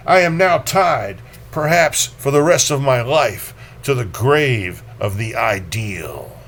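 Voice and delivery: measured, theatrical reading voice; poetry reading. Acted. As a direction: read it aloud like a stage poem. Reads a Baudelaire prose poem.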